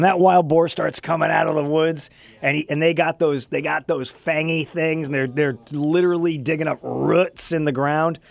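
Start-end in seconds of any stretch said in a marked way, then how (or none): none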